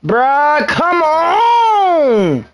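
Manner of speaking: high shouted outburst; real angry yell at the game; exasperated cry. Real, not acted. Teammate blunder, outburst.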